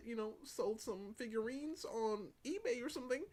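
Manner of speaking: high-pitched hemming and hawing over money; haggling imitation. Artist raising the price on Doki, high hedging mock voice.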